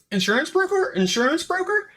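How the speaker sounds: high, squawking chicken-like cry of the words. Retold or imitated. Guy in the background squawking like a chicken. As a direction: cluck it out like a chicken asking a question. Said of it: "it just sounds like a chicken".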